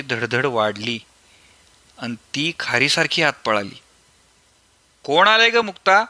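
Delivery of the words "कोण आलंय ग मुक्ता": called out loud and high from another room; raised calling voice. Acted. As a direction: shout the question toward the back of the house. Grandfather calls from inside house.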